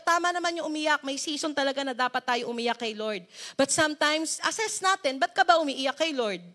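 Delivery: ordinary delivery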